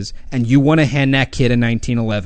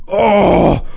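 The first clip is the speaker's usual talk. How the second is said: angry viewer; 3 semitones higher, 4 dB louder than usual. Retold or imitated. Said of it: "there's that guy right now, it's like".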